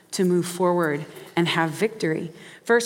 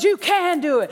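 much higher and louder, a push of encouragement; coach urging; 9 semitones higher, 4 dB louder than usual. Acted. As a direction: drive him on. Coach pushes the player.

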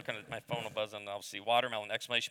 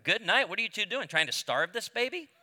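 put-on old crusty doctor scolding, pitched up; gruff doctor; 7 semitones higher than usual. Crusty doctor scolding new parents over baby's weight. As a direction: bark it like a blunt old doctor. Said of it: "the doctor says this".

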